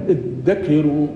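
ادكروا with a doubled, held d; no dh sound in ادكروا, only a strong double d; doubled dal. Shown as a demonstration. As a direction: say ادكروا as id-dakiru. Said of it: "هذه دال مشددة".

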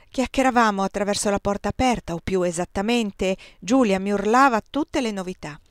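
ordinary delivery, nothing marked

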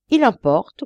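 loud, firm order; commanding. A narrator's reading. Judge orders the guard, loud commanding tone. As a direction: snap out the command. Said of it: "commanda-t-il".

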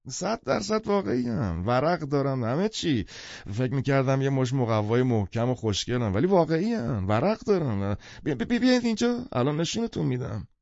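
high, swooping tipsy enthusiasm; excited half-drunk man. Acted. Half-drunk man eager to prove the books real, excited. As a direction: insist eagerly, stumbling over yourself in excitement.

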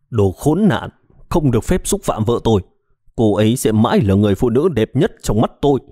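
ordinary delivery, nothing marked

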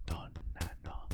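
soft unvoiced whisper. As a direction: breathe the words, no voice. Whispered shadowing of phrase.

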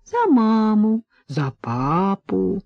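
put-on slow, drawn-out coaxing of a toddler. A narrator's reading. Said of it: "вкратчиво предлагала Надя". (вкрадчиво, нараспев)

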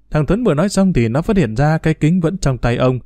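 usual speaking voice

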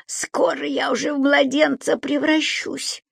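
high creature voice, groaning. A narrator's reading. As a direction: grunt it out like a tired little goblin. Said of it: "прокряхтел Субастик".

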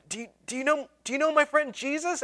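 high, pleading repeat of the question; pleading eager asking. Retold or imitated. Student pleads with strangers walking away, rising desperation. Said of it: "I was like".

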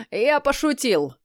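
put-on slow, laughing drunkard with a hiccup; drunken laughing. A narrator's reading. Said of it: "расхохотался Лотар, пьяно икая".

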